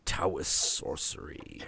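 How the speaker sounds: low, slow, whispered spooky line; mock mysterious whisper. Joking about the family's secret, a mock mysterious whisper. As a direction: breathe it like dark magic.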